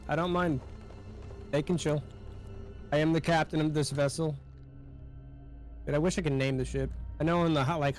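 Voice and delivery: ordinary talk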